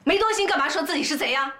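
high-pitched accusing questions; sharp retort. Acted. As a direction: challenge her, voice climbing. 婆婆反问儿媳. (抬高声调厉声反问)

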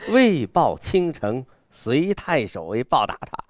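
高声、慢速地朗诵; 狂放有阵势. Shown as a demonstration. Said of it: "他的这种狂是很有阵势的". (气势高昂的朗诵)

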